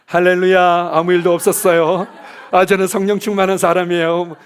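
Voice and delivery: loud, hammed-up cheer with a laugh; mock cheerful worship leader. Pastor pretending to lead worship after the fight, loud fake cheer. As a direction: fake it like nothing happened, laughing.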